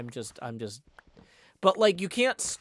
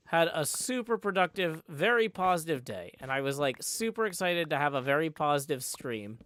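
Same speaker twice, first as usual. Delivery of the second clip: ordinary talk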